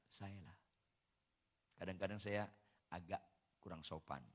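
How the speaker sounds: normal talking voice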